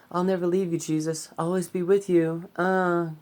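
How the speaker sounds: high, loud whiny pleading Peter; shitty Peter voice. Retold or imitated. A vlogger hams up Peter begging Jesus, high whiny voice. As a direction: overdo a clingy disciple, high and loud. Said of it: "that voice. That was a really shitty voice".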